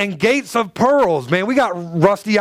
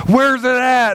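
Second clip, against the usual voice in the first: higher, urgent mock wife voice; concerned wife; 7 semitones higher, 3 dB louder, slower than usual. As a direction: imitate a wife fussing over him. Voicing anxious wife in the story.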